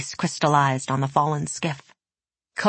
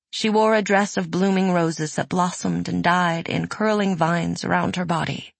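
plain reading voice

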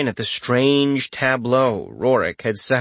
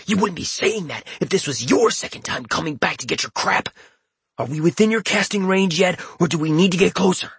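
gamer hero voice; 7 semitones higher than usual. Acted. Hero Poner Boner speaks.

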